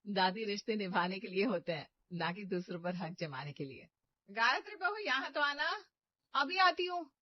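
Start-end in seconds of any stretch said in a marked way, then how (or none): 4.3-5.8 high call across the house; raised, carrying summons. Acted. Mother-in-law calls from another room. 6.3-7.1 high, loud reply from afar; raised answer across the house; calling back. Acted.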